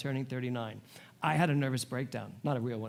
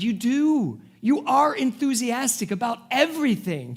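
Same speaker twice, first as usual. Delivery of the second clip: higher and louder, pressing the point home; retold firm encouragement; emphatic insistence; 9 semitones higher, 6 dB louder than usual. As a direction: insist she is enthusiastic. Insisting to a lonely client.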